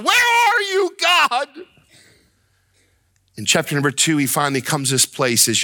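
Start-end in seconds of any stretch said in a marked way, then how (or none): 0.0-1.5 very high, drawn-out desperate cry; shouting at God. Acted. Preacher as Habakkuk searching for God, shouting. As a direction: call to the heavens at a pitch far above normal. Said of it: "He's shouting at God".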